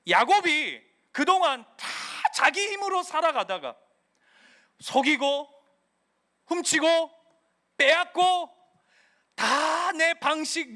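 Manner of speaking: loud, rising sermon cry; preaching at full force. Acted. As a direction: preach it out, building hard. Preaching builds to climax. (힘차게 외치는 설교)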